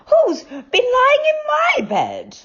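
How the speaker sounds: mother bear asking harder. A narrator's reading. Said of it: "said Mother Bear even louder".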